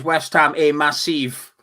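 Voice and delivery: put-on loud Scottish-accented boast; mock David Moyes. The host keeps mocking Moyes, loud and Scottish, to the Lyon manager. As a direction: bellow it in a hammed-up Scottish voice. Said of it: "Or Scottish".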